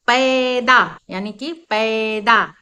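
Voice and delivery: पैदा drawn out slowly with a broad ऐ; slow demo word. Shown as a demonstration. Teacher slowly saying पैदा for young learners. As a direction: stretch पैदा so the ऐ rings. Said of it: "ए का sound आ जाता है".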